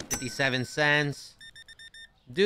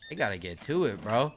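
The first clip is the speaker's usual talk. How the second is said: ordinary talk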